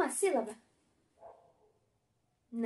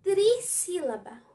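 slow teaching read; 3 semitones higher, 3 dB louder, slower than usual. Shown as a demonstration. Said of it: "Trissílaba é aquela palavra que tem três sílabas".

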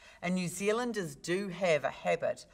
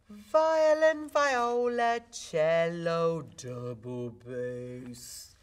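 stretching out the voice; 8 dB louder, much slower than usual. Shown as a demonstration. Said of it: "learn to stretch out your voice".

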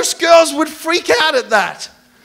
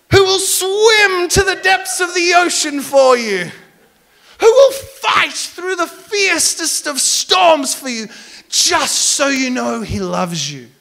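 hammed-up grand declaration; 4 semitones higher than usual. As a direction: declaim like a corny romantic hero. Retold corny romantic speech.

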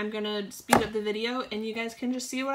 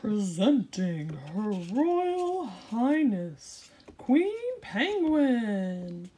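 slow grand announcer voice; pompous fussy courtier; 4 dB louder, much slower than usual. Acted. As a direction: announce her like a royal herald. Announcing the queen's entrance.

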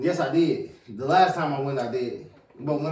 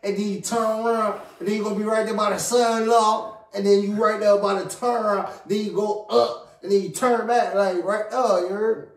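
a rambling Southern local giving directions, pitched a bit higher; Southern accent; 3 semitones higher than usual. Retold or imitated. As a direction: do a mock Southern local pointing the way. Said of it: "I always love how they talk down south".

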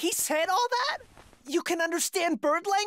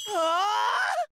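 shocked yelp. Acted. Girl leaving, he panics.

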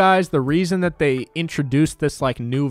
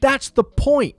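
exasperated retort; 8 semitones higher than usual. Retold or imitated. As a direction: spell it out to a clueless fan, pitched up. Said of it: "And I'm like".

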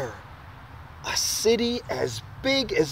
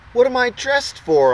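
loud question; 8 semitones higher, 7 dB louder than usual. Acted. Boy quizzes the crowd.

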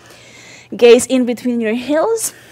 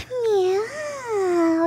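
high soft cat meow; playful meow; 8 semitones higher, 9 dB quieter than usual. Shown as a demonstration. Mother meows to baby in cat pose, high and soft. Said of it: "Maybe make some sound".

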